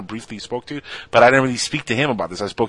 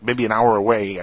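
usual speaking voice

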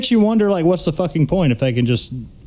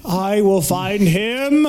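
mock villain line; 4 semitones higher, slower than usual. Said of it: "I say that every time I lose my keys".

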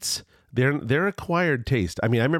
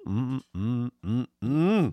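savoring hum; much slower than usual. Real, not acted. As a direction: hum like tasting something great. Savoring the imagined meal.

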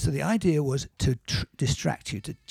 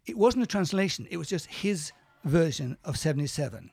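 ordinary talk, nothing marked

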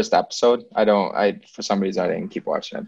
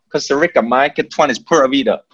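put-on Tico accent; 2 semitones higher than usual. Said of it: "that's literally how they say it".

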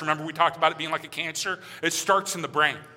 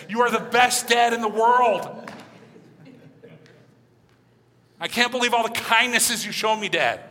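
put-on higher, louder gushing kid thanking dad; fantasy grateful child; 5 semitones higher, 4 dB louder than usual. Pastor playing his dream child thanking dad, gushing higher. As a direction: gush like a doting child, pitched up. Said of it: "this is my fantasy".

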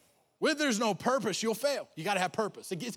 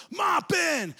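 high loud call of the name; bully yell; 10 semitones higher, 3 dB louder than usual. Retold or imitated. Bearded bully calling the boy out, loud yell. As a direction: bark the name across the field.

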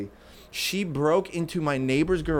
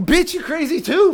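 much higher and louder, incredulous; outraged yell; 6 dB louder than usual. Retold or imitated. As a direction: blow up at the mother. Yelling at the mom.

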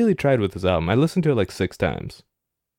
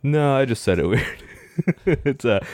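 ordinary delivery

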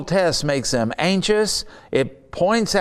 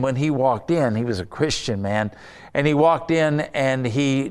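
usual speaking voice

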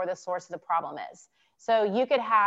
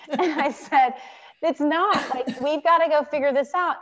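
talking through real laughter, higher and louder; laughing; 6 semitones higher, 6 dB louder than usual. Real, not acted. Retelling the patient's surprising answer, laughing. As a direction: laugh while getting the words out.

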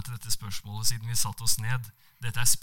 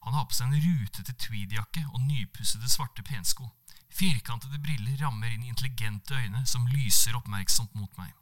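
plain reading voice

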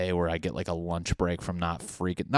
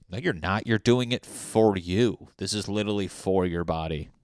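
ordinary talk, nothing marked

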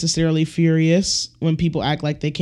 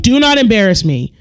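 firm, louder strict-parent warning; stern parent; 4 semitones higher, 6 dB louder than usual. Retold or imitated. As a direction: lay down the law to your kid. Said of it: "and they're like".

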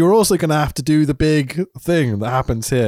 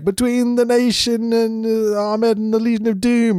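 mock commentator; 11 semitones higher than usual. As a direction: stretch it out high in mock announcement. Retelling commentators' letdown announcement.

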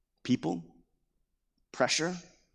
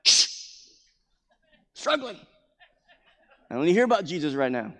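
mock hushing. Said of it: "you're like".

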